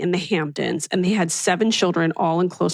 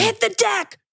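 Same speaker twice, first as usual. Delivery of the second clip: mock alarm; slower than usual. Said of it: "she was probably like".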